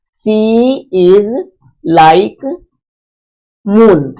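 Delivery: one word at a time, long pauses between; word-by-word dictation. Shown as a demonstration. Teacher writing last sentence's example for students, word-by-word dictation. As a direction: dictate it slowly as you write it out.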